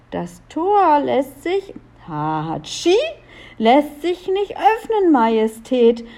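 high-voiced report broken by a sneeze; calling up from inside. A narrator's reading. Guard inside the castle reports to Leo, calling up. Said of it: "schallte es aus dem Burginnern herauf".

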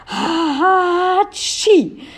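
high, drawn-out mock sneeze; acted sneeze. A narrator's reading. Guards showered with powder, sneezing loudly. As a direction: build a slow, high sneeze that bursts at the end. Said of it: "scholl es mehrstimmig zu Julia hinunter".

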